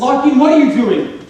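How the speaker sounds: heated quarrel; angry demand. Acted. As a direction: demand an explanation. Lovers quarrel.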